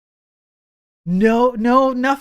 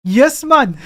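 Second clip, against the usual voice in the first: a hammed-up Indian accent that slides into Jamaican, pitched higher; clumsy Indian accent that comes out Jamaican; mock Indian accent; 6 semitones higher, 7 dB louder than usual. Streamer reading a chat message aloud in a botched, higher Indian accent. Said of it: "That's Jamaican".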